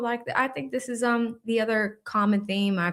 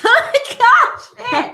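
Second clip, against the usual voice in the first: high, loud burst of laughter; cracking up at the cheeks comment; laughing; 9 dB louder than usual. Real, not acted. Laughing hard at a viewer's crude joke.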